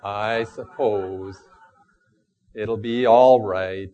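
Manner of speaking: lightly voiced slow mopey donkey; a hint of Eeyore's sigh; gloomy Eeyore. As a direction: drag it out like a resigned Eeyore. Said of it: "I'm a bit like Eeyore".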